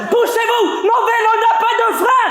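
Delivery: high, loud yell to clear the way; shouting warning. Acted. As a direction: shout at the pedestrians to get out of the way. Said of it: "Je crie".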